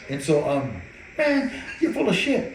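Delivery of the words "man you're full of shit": laughing retort. Retold or imitated. Brother laughing it off.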